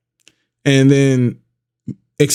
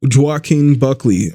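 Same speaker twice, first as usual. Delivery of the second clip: ordinary talk, nothing marked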